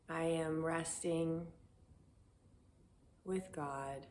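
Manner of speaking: quiet, level prayer to oneself; gentle affirmation. Shown as a demonstration. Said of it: "these gentle commands".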